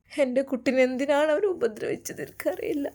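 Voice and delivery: high, tearful wail; acted weeping of a mother in anguish; sobbing mother. A narrator's reading. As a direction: wail it as if choked by tears. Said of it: "ഉമ്മ വിങ്ങി പൊട്ടിക്കരയുകയാണ്".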